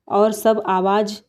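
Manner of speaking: usual speaking voice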